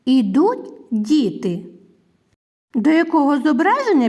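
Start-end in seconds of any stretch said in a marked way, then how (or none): 0.0-1.7 slow, separated words for building a sentence; deliberate teacher's model sentence. Shown as a demonstration. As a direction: say Ідуть, pause, then діти, slowly and clearly. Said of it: "склади речення".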